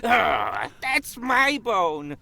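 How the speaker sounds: high, slow possessive dog; defensive pup guarding a bone; cartoon dog voice. Dog voice in script.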